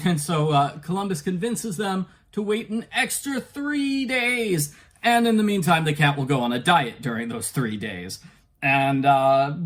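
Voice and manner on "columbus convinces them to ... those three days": ordinary talk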